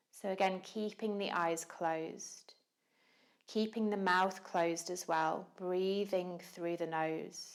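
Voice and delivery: calm, unhurried yoga teacher voice with long pauses; measured meditation cueing, leaving room to breathe; guided meditation. Real, not acted.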